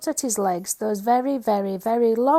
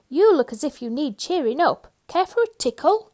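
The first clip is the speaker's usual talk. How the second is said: higher, louder playful offer; cheery Mister Tickle; 7 semitones higher, 6 dB louder than usual. Acted. Mister Tickle offering Mister Tall a tickle, playful and high. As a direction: tease him with a tickle.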